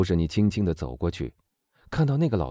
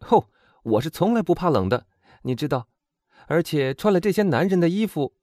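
put-on high, animated young woman's voice; lively storybook heroine, high and eager; confident young heroine; 10 semitones higher, 5 dB louder than usual. A narrator's reading. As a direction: reassure a friend with a plan, pitched high.

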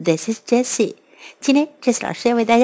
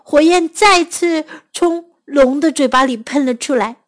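ordinary delivery, nothing marked

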